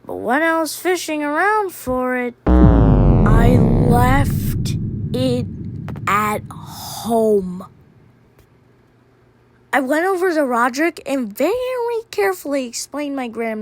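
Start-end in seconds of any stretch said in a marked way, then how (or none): 2.5-7.7 very slow, drawn-out words; dawning realization. Acted. Narrator finding the number missing, slow stunned admission. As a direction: let each word land slowly as it sinks in.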